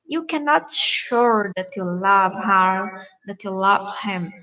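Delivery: ordinary delivery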